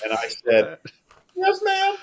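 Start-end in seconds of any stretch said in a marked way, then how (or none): 1.4-2.0 squeaky, much higher mock surprise; answering the call for President Uchtdorf, mock startled; startled yes ma'am. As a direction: jump way up in pitch, caught off guard. Told to hold for President.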